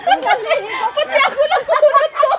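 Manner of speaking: loud, high, laughing yell; laughing shout. Real, not acted. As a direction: shout while cracking up. Just got soaked, laughing.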